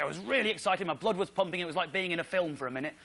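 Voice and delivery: usual speaking voice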